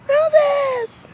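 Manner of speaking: very high squeal of delight; excited squeal. Real, not acted. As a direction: squeal the word gleefully. Excited garden tour exclamation.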